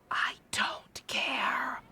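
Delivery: slow breathy whispered brush-off; lazy whispered dismissal; mock whisper. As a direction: breathe it out slowly, not bothering. Streamer dismisses game line.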